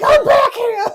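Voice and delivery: high, loud yell after her; shouted chase call. Acted. A character screaming high and loud after the fleeing thief.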